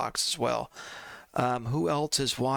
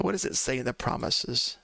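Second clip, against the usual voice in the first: normal talking voice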